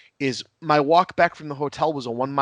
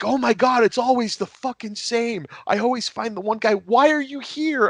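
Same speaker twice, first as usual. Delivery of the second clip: high, exasperated voice, much higher pitch; 10 semitones higher than usual. Retold or imitated. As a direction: play the fed-up guy who always picks the straight one. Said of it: "they're like".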